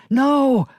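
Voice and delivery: sudden desperate shout of no; scream. A narrator's reading. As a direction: yell it, high and loud. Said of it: "just as Shep screamed".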